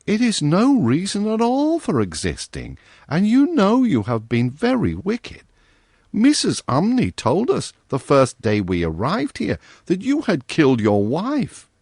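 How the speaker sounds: a hint of a kind little girl; young girl voice. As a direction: gently comfort him as a young girl. Virginia scolds the ghost.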